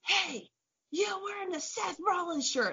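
mock yell pitched well up, ordinary volume; high-pitched imitation of a heckler; retold yelling. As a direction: imitate a stranger hollering an accusation. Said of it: "starts yelling at me like".

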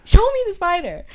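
high, loud, urgent demand; demanding, freaked out. Retold or imitated. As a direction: insist on seeing the spider. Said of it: "I was kinda freaking out".

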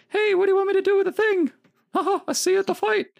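very high, loud, fast squeaky cartoon voice; squeaky muscle-guy impression. Mocking the bodybuilders' unexpected voices, high and squeaky. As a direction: squeal it out fast in a ridiculous falsetto. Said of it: "But instead you get".

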